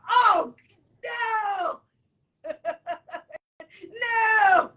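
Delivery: high, loud laughing "oh no"; mock horror. Telling a friend how his kiss shocked her, laughing horror.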